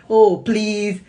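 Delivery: a mother finally asking kindly; sweet please. Retold or imitated. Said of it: "the beauty that came through that".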